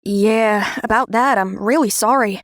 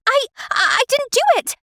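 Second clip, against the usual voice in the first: much higher, flustered stutter; stammering denial; 12 semitones higher than usual. Acted. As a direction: panic at being accused. Accused of keying the car.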